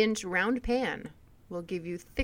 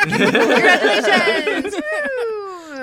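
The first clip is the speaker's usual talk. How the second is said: excited cheer; 10 semitones higher, 12 dB louder, much slower than usual. Real, not acted. Cheering the winner.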